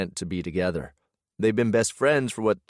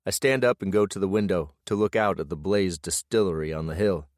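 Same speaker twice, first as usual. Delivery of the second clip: ordinary delivery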